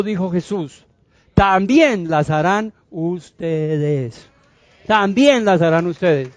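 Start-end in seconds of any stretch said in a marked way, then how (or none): 1.3-4.3 very slow, stretched-out words; deliberate, drawn-out proclamation; emphatic slow delivery. Acted. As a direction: draw out "también" and "ustedes" for weight. Preacher stresses promise word by word.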